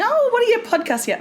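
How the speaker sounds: bright quick mum question; mock mum voice. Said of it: "I'm talking to her".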